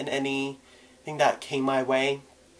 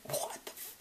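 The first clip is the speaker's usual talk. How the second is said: whisper; 16 dB quieter than usual. Retold or imitated. Lunchroom reacting in shock.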